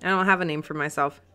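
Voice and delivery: usual speaking voice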